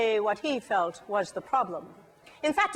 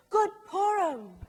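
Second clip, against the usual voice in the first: cheerful greeting; 6 semitones higher than usual. Acted. Greets people in street.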